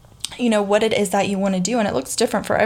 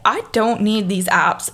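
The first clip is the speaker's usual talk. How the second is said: ordinary talk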